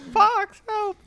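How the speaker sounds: high-pitched cry for help. Pretend. Star Wars joke riffing.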